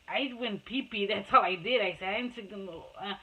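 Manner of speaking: usual speaking voice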